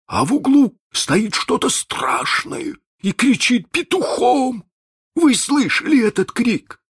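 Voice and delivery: high, frightened pirate voice. Acted. Chako tells his crewmates of the horror, high and scared. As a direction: tell it with rising dread.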